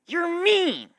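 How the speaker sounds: put-on high, loud childish yell; accusing shout. Pegasus interrupting his apology, shouting high and loud. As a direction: hurl it at him like a kid's accusation.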